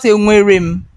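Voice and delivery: wrong "enwerim", rushed without the n; mispronounced enwerem. Shown as a demonstration. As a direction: say enwerem wrongly, quick with "rim" ending. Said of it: "It's not".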